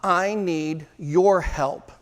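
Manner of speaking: very slow, each word weighted; slow earnest plea. Shown as a demonstration. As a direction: say it slowly like a sincere request for help. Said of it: "Most powerful words in selling".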